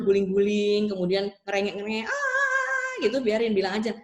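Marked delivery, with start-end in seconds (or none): 2.1-2.9 a short, high mock whine of a fussing child; high child's whine. Parent imitating a tantruming child: high mock whine. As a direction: squeal it like a child throwing a tantrum.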